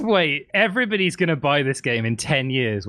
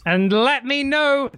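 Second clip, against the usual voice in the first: mock sign-off voice; 11 semitones higher than usual.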